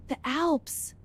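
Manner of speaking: breathless gasp, whispered words; awed gasp. Real, not acted. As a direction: gasp at the mountains. First sight of the Alps.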